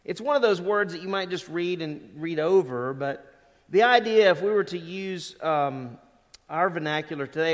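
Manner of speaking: ordinary delivery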